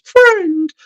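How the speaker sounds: very high, silly squeak of "Friend"; a falsetto "Friend" with a falling tune; In Betweeners impression. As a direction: imitate the sitcom character's daft squeal. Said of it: "a little bit like In Betweeners".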